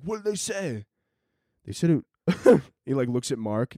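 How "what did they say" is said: soft, tense, higher question; quiet question. Acted. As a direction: lean in and ask softly. NASA guy asks quietly.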